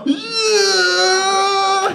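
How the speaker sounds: high, loud mock whoop; thrilled scream. Gung-ho rider screaming at the bottom, in a high mock yell. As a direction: yell it like the ride of your life. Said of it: "He was like".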